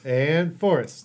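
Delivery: slow, loud, announcer intro. Acted. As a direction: boom out the name. Host announcing co-host dramatically.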